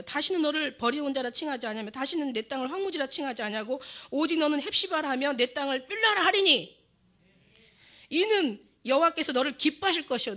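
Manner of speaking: loud, declaiming preacher reading the verse; scripture reading. Acted. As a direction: proclaim the verse to the congregation. Reads scripture aloud. (설교조로 힘 있게 낭독)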